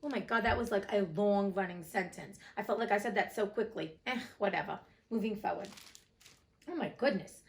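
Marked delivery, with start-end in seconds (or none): none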